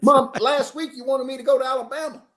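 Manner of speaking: high-pitched mock protest; incredulous son. Son protesting to his mom about last week, incredulous.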